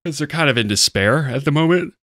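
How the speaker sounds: words stretched by a yawn. Real, not acted. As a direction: talk through a yawn. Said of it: "Sorry, I had a yawn".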